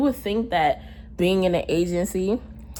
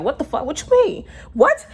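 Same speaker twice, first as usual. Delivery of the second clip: much higher, alarmed retold questions; panicking; 9 semitones higher, 3 dB louder than usual. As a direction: act out a panicked outburst, pitch jumping up. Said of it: "I'm panicking now".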